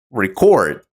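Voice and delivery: "record" as re-CORD, the verb; stress on second syllable. Shown as a demonstration. Teacher shows the verb record, stressed on CORD. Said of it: "if you stress the second syllable".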